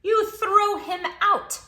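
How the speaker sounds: high, loud, slow declaration; emphatic answer. Acted. Reader tells kids what to do, loud and high. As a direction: announce it triumphantly.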